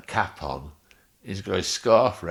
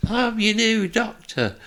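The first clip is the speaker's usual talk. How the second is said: much higher, much louder, slow ad voice; mock advert voice; 8 dB louder than usual. Friend [name] mocking him with a claims advert. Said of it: "[name] joking".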